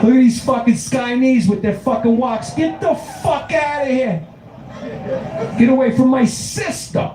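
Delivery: high-pitched, strained Brooklyn yelling; outraged Brooklyn Italian. Acted. Brooklyn Italian driving aliens away from his sister, high outraged yelling. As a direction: blow up in high-pitched indignation.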